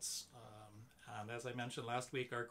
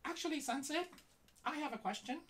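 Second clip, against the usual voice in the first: high squeaky puppet voice; squeaky ventriloquist dummy; 14 semitones higher than usual. Acted. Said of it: "you have this really high-pitched voice".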